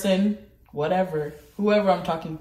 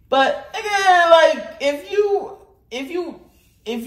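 emphatic scold; 10 semitones higher, 6 dB louder, slower than usual. Real, not acted.

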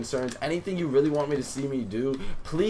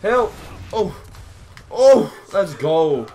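short, high, loud bursts; high-pitched hype yells; excited shouting; 7 semitones higher, 9 dB louder, much slower than usual. Real, not acted. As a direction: shout out in the heat of the fight. Calling out mid-fight.

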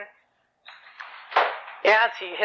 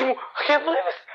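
hammed-up disbelief, pitched high; mock scream; 7 semitones higher than usual. Mother reacting to her son's confession, mild mock scream. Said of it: "She starts screaming".

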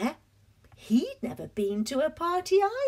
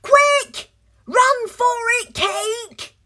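very high, loud urgent cry; urgent shout; 10 semitones higher, 8 dB louder, slower than usual. Acted. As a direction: hurry him to flee. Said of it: "a wibbly-wobbly voice said".

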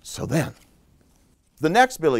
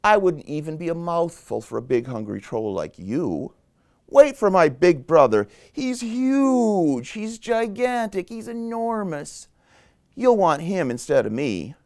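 higher-pitched goat plea; middle goat pleading; 6 semitones higher than usual. Acted. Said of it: "middle Billy Goat Gruff said".

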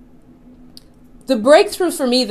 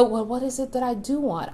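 much softer, calm self-question; gentle; 10 dB quieter than usual. Shown as a demonstration. Calming herself in a spat with her son, softly. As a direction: soften into the question mid-argument. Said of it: "this gentle movement".

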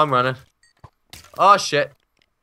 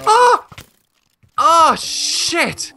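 high, shocked yelp; shocked cry; 4 dB louder, much slower than usual. Real, not acted. Killed by creeper.

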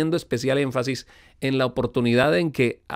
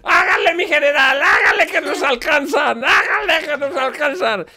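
put-on loud high frantic drunk yelling; belligerent drunk; 5 dB louder than usual. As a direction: shout like a drunk fleeing. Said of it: "Hacer como de jinete el borracho".

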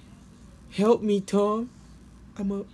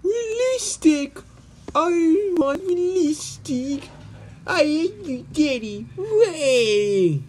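put-on high, bratty little girl voice; a spoiled kid pouting at her dad; whiny child; 10 semitones higher than usual. Child whines at her father.

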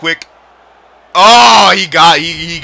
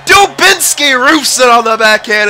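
high, loud whoop breaking into laughter; triumphant shout; 13 semitones higher, 7 dB louder, much slower than usual. Real, not acted. Gamer cheering the shootout winner, laughing whoop. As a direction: celebrate the winning goal. Shootout winning goal celebration.

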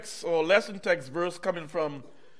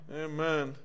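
ordinary talk

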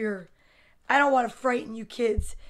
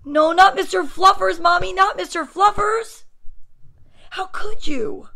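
loud, high, distraught protest; pleading kid; 7 semitones higher, 9 dB louder than usual. A narrator's reading. Boy begging mom to spare his bunny, loud pleading. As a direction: wail it like a betrayed child.

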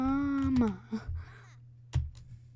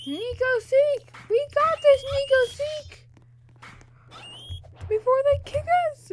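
excited shouting; 13 semitones higher, 5 dB louder than usual. Real, not acted. Kid cheering on Roblox game.

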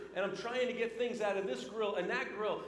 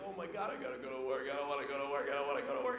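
low, fast, dreading mutter; panicky dread; 7 semitones lower, 3 dB quieter, much faster than usual. Retold or imitated. Young worker dreading his shift, panicky low mutter. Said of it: "I was like".

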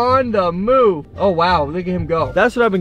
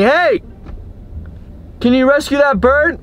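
calling across; 9 semitones higher than usual. Retold or imitated. Calling out to neighbor by pool.

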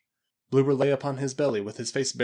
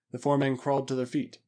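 ordinary delivery, nothing marked

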